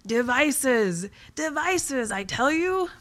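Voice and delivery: high, rising theatrical cry; melodramatic exclamation. Acted. Blogger proclaiming writing devices to readers, melodramatic exclamation.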